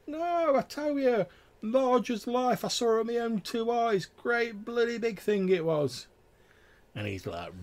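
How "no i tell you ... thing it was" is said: very high, drawn-out rustic voice; wailing country yokel swearing he saw it; insistent tavern tale-teller. Acted. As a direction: stretch it out high in amazed protest.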